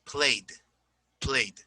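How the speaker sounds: "played" as one syllable; -ed as plain D. Shown as a demonstration. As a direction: end "played" straight on a d. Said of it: "See, not played".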